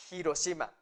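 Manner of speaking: HIROSHIMA with a clearly sounded h at the start; H pronounced. Shown as a demonstration. Teacher giving the only valid form, h pronounced. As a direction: breathe out the h to begin HIROSHIMA. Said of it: "Están pronunciando la H".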